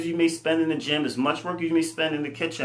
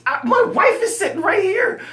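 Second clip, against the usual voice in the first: much higher, strained disbelieving voice; exasperated mock squeal; 5 dB louder than usual. Pointing out his wife to his brother, high mock squeal.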